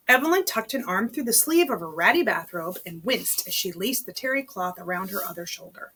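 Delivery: plain reading voice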